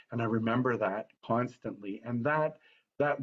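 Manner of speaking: normal talking voice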